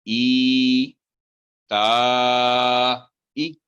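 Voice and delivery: ई ता ई stretched long and melodic; slow recitation. Shown as a demonstration. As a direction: recite slowly, holding the vowels. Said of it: "teacher models recitation".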